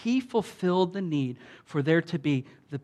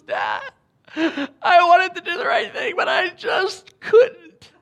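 put-on high sobbing child's plea, much higher and louder; imitating a crying child; 14 semitones higher, 6 dB louder than usual. Father retells his daughter's tearful confession, high sobbing plea. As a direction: voice his young daughter breaking down in tears, slowing at the end. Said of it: "she breaks down crying".

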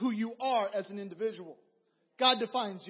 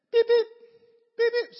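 high, loud mock beeping; card reader beep; 12 semitones higher, 11 dB louder than usual.

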